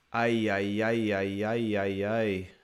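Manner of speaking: low, soft ay ay ay lament; mock lament. Real, not acted. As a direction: wail a sorry ay ay ay. Lament after red card.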